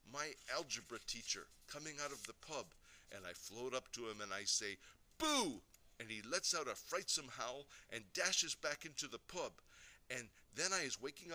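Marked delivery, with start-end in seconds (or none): 5.2-5.6 high, loud sudden burst; startling boo. A narrator's reading. Invisible boy sneaking up on his teacher, startling shout. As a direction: pounce on the word to scare someone.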